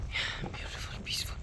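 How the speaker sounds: very soft awed whisper; awed whisper in the peaceful monastery. Real, not acted. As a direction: breathe the words in quiet wonder.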